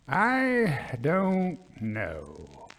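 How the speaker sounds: very slow, drawn out; a slow, hesitant colonel's reply; slowly. Acted. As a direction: let each word hang. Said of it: "answered her guest slowly".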